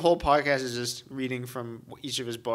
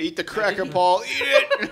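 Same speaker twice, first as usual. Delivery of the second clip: laughing taunt; 8 semitones higher, 5 dB louder than usual. Pretend. Taunting Paul through laughter.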